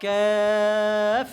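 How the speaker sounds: "কাফ" with a light fronted vowel like "ke-ef", held long; long, light model "কাফ"; thin kaf. Shown as a demonstration. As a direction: stretch "কাফ" thin and level, ending on f. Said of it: "পাতলা উচ্চারণ".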